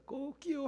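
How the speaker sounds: high rooster crow imitation. (높은 닭 울음 흉내)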